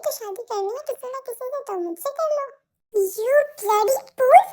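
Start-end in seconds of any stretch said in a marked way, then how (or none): 2.9-4.4 louder, drawn-out furious insult; angry insult. Acted. Girl scolds the pushy suitor in a slow angry shout. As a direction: spit the insult out slowly.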